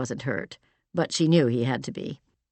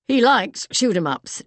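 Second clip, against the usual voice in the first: put-on light East London accent, pitched higher and a little louder; a touch of East London in a higher voice; 6 semitones higher, 4 dB louder than usual. A narrator's reading. Doug talks about Roberto as if he weren't there, in an East London accent. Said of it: "said in his East London accent".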